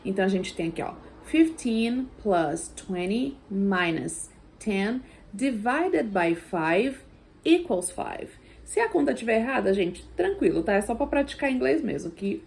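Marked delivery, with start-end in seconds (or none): 1.2-8.3 fifteen plus twenty minus ten, read slowly word by word; slow practice read. Shown as a demonstration. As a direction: read the sum out slowly for students. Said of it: "eu vou falar o primeiro".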